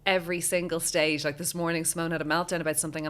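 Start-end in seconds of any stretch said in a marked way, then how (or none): none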